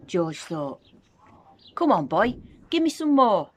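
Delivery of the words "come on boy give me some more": loud, high demanding yell. A narrator's reading. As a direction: bark the order at the boy. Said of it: "she yelled".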